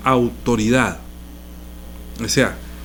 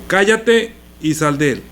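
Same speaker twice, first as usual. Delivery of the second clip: slow, firm order to the demon, higher; commanding rebuke; 4 semitones higher than usual. Acted. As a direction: cast the demon out with authority. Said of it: "le habló fuerte". (reprensión firme y pausada)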